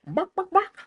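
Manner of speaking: high yappy bark; squeaky imitated barking; mock barking. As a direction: yap like a little dog. Imitating the dog.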